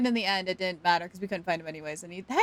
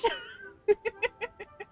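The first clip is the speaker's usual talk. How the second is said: real giggle far above the speaking voice; high giggle; 14 semitones higher than usual. Real, not acted. Streamer laughs at chat about Twitch, high giggle. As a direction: let out high, rapid giggles.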